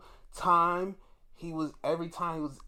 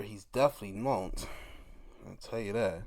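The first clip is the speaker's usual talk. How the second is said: normal talking voice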